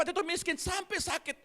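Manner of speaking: ordinary talk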